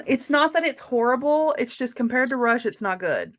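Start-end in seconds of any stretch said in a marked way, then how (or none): none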